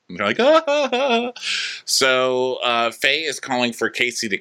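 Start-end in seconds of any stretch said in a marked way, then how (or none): 0.4-1.3 very high, loud mock "ah ah ah" laugh; mock fake laugh. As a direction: cackle like the fake-laughing guests. Guests laughing mockingly.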